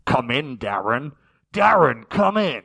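loud radio call, starting low; mock dispatcher hailing over the radio; walkie-talkie voice. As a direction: call through a crackly two-way radio. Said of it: "his walkie-talkie would make noises".

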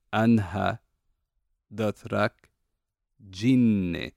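slow Dothraki "Anha dotrak jinne", word by word; Dothraki demo. Shown as a demonstration. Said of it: "the way you're supposed to say it".

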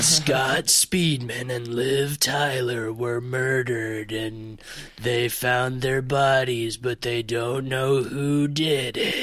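low, slow horror trailer narration; deep scary voice. Retold or imitated. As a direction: intone it like a seventies narrator. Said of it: "Deep, deep, scary voice".

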